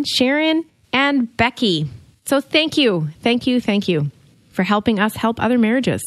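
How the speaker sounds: ordinary talk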